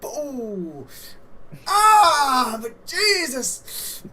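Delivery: high, loud, drawn-out cry; pained outcry. Acted. Player reacts to a kick in the crotch, pained outcry. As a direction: yelp it out long and loud.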